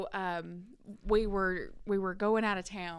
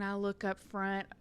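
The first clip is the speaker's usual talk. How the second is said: normal talking voice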